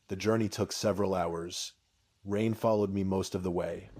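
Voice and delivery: plain reading voice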